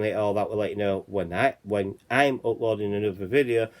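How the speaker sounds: ordinary delivery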